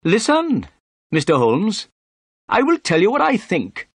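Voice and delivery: high-pitched, pompous inspector; self-important police inspector laying down his view. A narrator's reading. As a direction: hold forth importantly in a high character voice. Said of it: "he said importantly".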